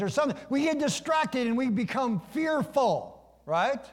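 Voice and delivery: high, urgent sermon cadence; impassioned preaching. Real, not acted. Preacher warns that we get distracted like Peter, impassioned preaching. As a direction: stretch key words, drive each phrase home.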